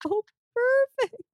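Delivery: high, slow, dry "perfect"; drawn-out fake delight, stung; sarcastic high. Retelling her date, sarcastic high "perfect". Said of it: "And I'm like".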